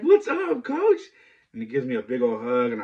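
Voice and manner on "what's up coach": very high, loud, rising greeting; excited greeting. Retold or imitated. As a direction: greet your coach with joy. Kid greeting coach.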